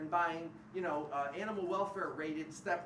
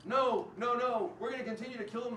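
higher and louder, hammed-up stubborn company; mock corporate refusal; 6 semitones higher, 5 dB louder than usual. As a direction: play the company digging in its heels, mockingly. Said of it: "Kentucky Fried Chicken in Canada says".

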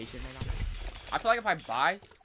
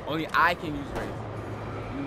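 slow; much slower than usual. Real, not acted. Claiming his character.

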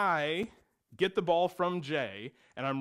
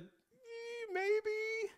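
much higher, squeaky, guilty maybe; sheepish maybe; 6 dB quieter than usual. Real, not acted. Admitting brothers had fun at his expense, squeaky and sheepish. Sheepish admission about siblings.

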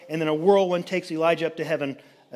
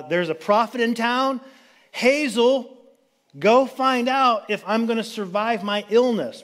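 higher, raised kingly voice sending a servant off; sick king commanding; 6 semitones higher than usual. Retold or imitated. Sick king sends Hazel to the prophet, higher commanding voice. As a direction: play the anxious king giving orders.

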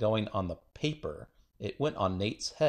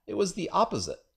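put-on protesting boy; 8 semitones higher, faster than usual. A narrator's reading. Boy blaming the opposite.

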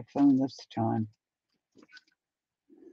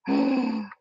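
awed gasp; 4 semitones higher than usual. Real, not acted. Delighted vlogger finding the wanted piece, awed gasp. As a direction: gasp at a lovely find.

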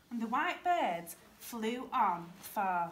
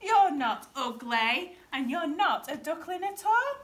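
high, loud, emphatic reassurance; storybook swans correcting him brightly, rising in pitch; 8 semitones higher, 7 dB louder than usual. A narrator's reading.

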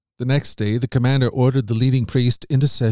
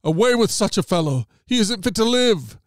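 high-pitched angry crowd cry, rising higher; crowd shouting; 7 semitones higher than usual. A narrator's reading. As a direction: cry it out like an enraged mob, pitch climbing. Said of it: "Then they all began to shout".

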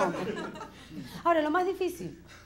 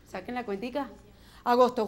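normal talking voice

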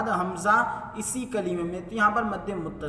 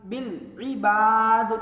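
slow, drawn-out tajweed chant; chanted Quranic recitation; 4 dB louder, much slower than usual. Shown as a demonstration. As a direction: recite melodically, stretching the vowels. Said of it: "मद्दे आरीज का कायदा हो रहा है".

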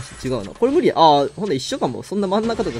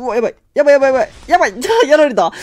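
panicked; 9 semitones higher, 8 dB louder, faster than usual. Real, not acted. Losing a rally in game.

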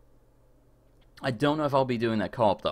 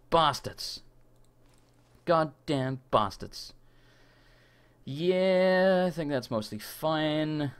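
normal talking voice

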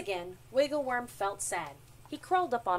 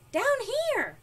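high, loud little creature voice; tiny squeaky shout; squeaky calling; 13 semitones higher, 8 dB louder than usual. A narrator's reading. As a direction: call up from the ground. Tiny ant calling to worm.

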